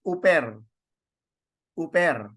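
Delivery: uper with no H, opening straight on the vowel; uper, the smooth breathing version; without the h. Shown as a demonstration. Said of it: "the other one is without the H".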